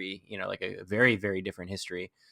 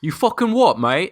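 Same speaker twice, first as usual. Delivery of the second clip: loud, high mock British lad; British lad accent; 10 semitones higher, 12 dB louder, slower than usual. Cohost playing along as a British lad, loud British accent. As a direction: fire back in a thick British accent.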